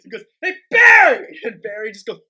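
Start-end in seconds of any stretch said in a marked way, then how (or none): 0.4-1.4 high, very loud mock holler; imitated shout. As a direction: act out hollering for someone. Retelling the video's shouted call.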